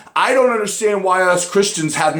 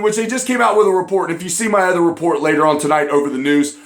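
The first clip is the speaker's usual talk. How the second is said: normal talking voice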